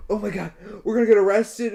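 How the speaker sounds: high, loud panicked friend; paranoid panic. Retold or imitated. Imitating his paranoid friend's high panicked voice. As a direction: mimic the stoned friend freaking out. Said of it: "is always paranoid when he's high".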